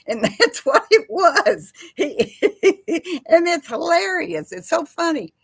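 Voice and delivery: real amusement spilling over; laughing. Real, not acted. Chatting to viewers after the parrot clip, laughing hard.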